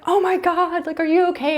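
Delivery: put-on high, loud alarmed gasp over a fallen toddler; panicked caregiver. Caregiver panicking as toddler falls, high and loud. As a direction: rush in panicking, voice shooting up. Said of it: "maybe the caregiver is like".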